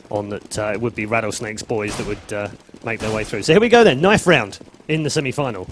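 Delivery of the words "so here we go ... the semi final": loud hyped announcement; excited announcer. Acted. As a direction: pump up the round start. Announcing the knife round.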